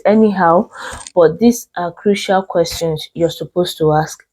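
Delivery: usual speaking voice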